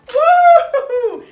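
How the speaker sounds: high, very loud slow whooping laugh; gleeful whoop. Acted. The wolf whoops over a pancake in his tub. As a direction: whoop with joy at the top of the voice.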